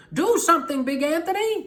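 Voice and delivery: high, loud worried shout; townspeople shouting. A narrator's reading. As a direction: plead loudly with him. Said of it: "they shouted".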